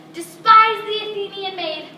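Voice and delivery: loud declaration. Acted. Puck finds the sleepers.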